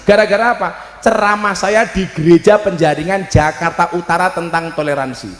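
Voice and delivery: normal talking voice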